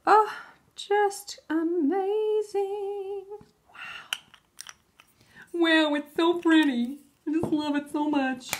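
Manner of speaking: high, loud gushing, drawn out; delighted gushing. Real, not acted. Vlogger gushes over the new stamping color. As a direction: gush over how pretty it is.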